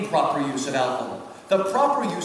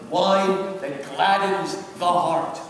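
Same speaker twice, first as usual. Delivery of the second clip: slow quote; much slower than usual. Shown as a demonstration. Repeating verse, even slower.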